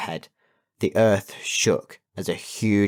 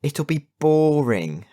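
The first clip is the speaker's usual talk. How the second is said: drawn-out, higher-pitched moan of boredom; groaning complaint; 7 semitones higher, 5 dB louder, slower than usual. A narrator's reading. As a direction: groan it out, stretching the word boring. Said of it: "We groaned".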